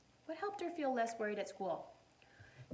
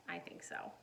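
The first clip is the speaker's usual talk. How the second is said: soft whispered answer; 8 dB quieter than usual. Real, not acted. Teacher whispering her answer to the children. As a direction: whisper it like a secret.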